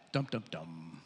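very low, soft suspense sting; ominous dum-dum-dum. Shown as a demonstration. Preacher reveals it was the Sabbath, ominous sting. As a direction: intone a hushed dramatic sting. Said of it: "Why do I say dum, dum, dum?".

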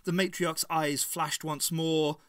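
ordinary delivery, nothing marked

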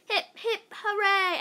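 high, loud cheer; yelled cheer. Acted. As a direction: cheer it out like a crowd. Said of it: "everybody yelled".

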